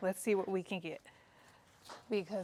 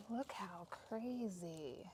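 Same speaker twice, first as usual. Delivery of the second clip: soft, drawn-out marvelling; hushed amazement; 10 dB quieter, much slower than usual. Real, not acted. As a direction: marvel quietly, slowly to yourself. Quietly marvelling at harvest.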